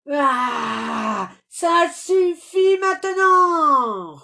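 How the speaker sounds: crié très fort et aigu, en traînant; rugissement furieux. A narrator's reading. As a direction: hurle ta colère comme un lion qui rugit. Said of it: "rugit le lion". (rugissement plus haut et plus fort, lent)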